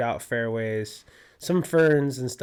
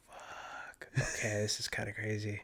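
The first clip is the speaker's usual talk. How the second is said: hushed awe; 3 semitones lower, 10 dB quieter than usual. Retold or imitated. Retold moment the edible hits.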